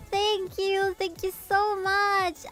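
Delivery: high, slow, gushing thank you. Retold or imitated. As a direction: thank her over and over, slowly. Grateful reply quoted high.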